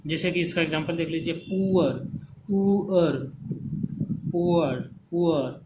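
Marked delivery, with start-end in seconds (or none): 1.4-5.6 पुअर stretched, "poo-uhr", falling at the end; emphatic model of पुअर with both vowels heard; two vowels sounded. Shown as a demonstration. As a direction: hold the "oo" in पुअर then drop into "uh". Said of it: "ऊ और ओ का sound आएगा".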